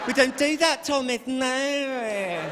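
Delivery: high, prim mock English accent; unruffled English nanny, drawn out and high. English nanny scolding Thomas, high controlled English voice. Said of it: "this English nanny comes over".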